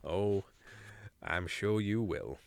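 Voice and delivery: low, soft, slow wry reply. Acted. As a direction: say it with a wry smile. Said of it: "Luke smiled awryly".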